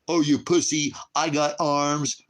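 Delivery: high, loud, very slow sneer; mocking tough guy. Mocking armed critics, a high, loud, slow sneer. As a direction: lampoon the gun owner.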